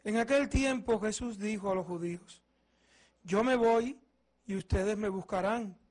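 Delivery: normal talking voice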